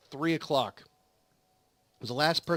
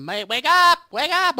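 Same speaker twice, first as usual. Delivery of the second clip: loud, high mock yell of a nurse; retold nurses yelling; 10 semitones higher, 9 dB louder, slower than usual. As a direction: yell him awake like a nurse. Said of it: "the yelling again".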